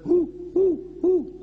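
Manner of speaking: high hooting owl call. Shown as a demonstration. Preacher imitating a sick owl, high hooting. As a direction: hoo like an owl. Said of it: "Owl, you know the bird?".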